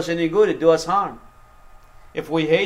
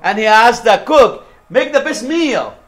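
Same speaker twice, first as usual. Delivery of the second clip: higher, louder pulpit storytelling; full-force preaching; 8 semitones higher, 7 dB louder than usual. Real, not acted. Preacher tells congregation of Joseph testing his brothers, full-force preaching. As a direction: drive the story out loud and high.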